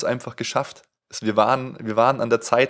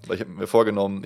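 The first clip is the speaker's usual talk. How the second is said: usual speaking voice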